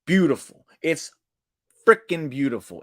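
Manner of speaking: high, loud burst of delight; thrilled praise. Real, not acted. Fan cheering Jey Uso's save, loud delighted outburst. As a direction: cry out in sheer delight.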